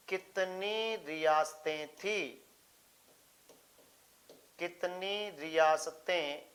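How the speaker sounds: very slow, word by word; slow dictation. Real, not acted. Teacher writing out an exam question for students, slow dictation. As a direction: dictate it slowly as you write.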